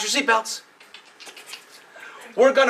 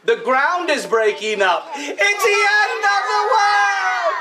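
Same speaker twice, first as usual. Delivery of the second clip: teacher leading the class in a panicked chant; mock panic; 7 semitones higher than usual. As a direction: cry out like the sky is falling. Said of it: "the first two said".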